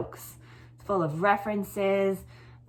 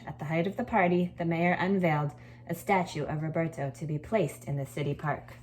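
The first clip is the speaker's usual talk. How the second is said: lively read-aloud, lifting the key words; storytelling voice. A narrator's reading. Teacher reading a story to her class, lively storytelling voice. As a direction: read it like a picture book to a class.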